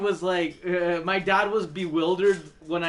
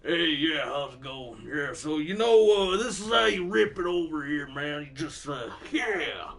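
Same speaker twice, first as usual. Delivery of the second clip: bearded rocker voice; 4 semitones lower than usual. Retold or imitated. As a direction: lean back into a lazy metal-dude voice. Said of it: "it was like".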